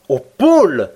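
loud, high astonished exclamation; incredulous cry. A narrator's reading. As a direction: blurt it out in disbelief. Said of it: "m'écriai-je".

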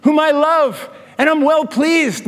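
"This is my son" said high and slow; heavenly father affirming his son, voiced high; God the Father speaking. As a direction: voice a proud father from heaven, lifted and slowed. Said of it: "And he says".

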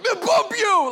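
high, loud burst of temper; mock angry outburst. Man taking out his anger on others, high angry shout. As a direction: lash out in exaggerated rage. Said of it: "taking out your anger towards others".

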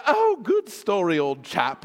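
drawn-out, pleased British approval; British old chap. Acted. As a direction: play a hammed-up English chap. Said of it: "I shut the Bible and I say".

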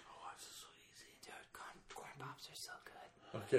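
very soft whisper, barely any voice; whispered aside. Pretend. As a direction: whisper it like a guilty confession. Whispered aside about Corn Pops.

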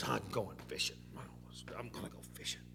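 low, soft grumble to himself; under-the-breath grumble, a little mad; grumbling. Acted. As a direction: mutter it sullenly, dropping away. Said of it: "grumbling a little bit".

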